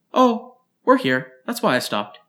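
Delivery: put-on high, quick childlike chatter; lightly voiced eager child; curious girl rattling off answers; little girl.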